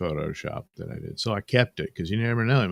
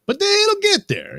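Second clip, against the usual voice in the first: squeaky aside; 5 dB louder than usual. Shown as a demonstration. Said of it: "repeated line, first take high".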